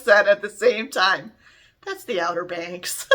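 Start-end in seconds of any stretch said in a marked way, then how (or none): 1.8-3.1 said through a laugh, a bit softer; laughing recognition. Retold or imitated. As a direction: let the laugh break into the line. Both recognise the location, laughing.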